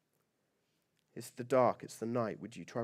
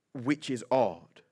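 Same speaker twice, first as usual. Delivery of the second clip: slow deadpan; slower than usual. Acted. Repeated punchline, slowed down.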